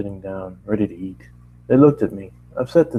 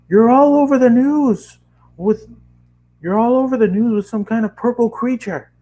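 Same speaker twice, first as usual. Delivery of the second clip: hammed-up upset dad calling his son; angry dad voice; 13 semitones higher, 4 dB louder than usual. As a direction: play the furious father on the phone. Dad scolding on phone.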